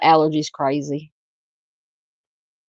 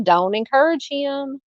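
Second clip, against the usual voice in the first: softer repeat; 7 semitones higher, 3 dB quieter than usual. Real, not acted. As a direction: let it trail, half laughing at it. Host repeats the scolding, softer.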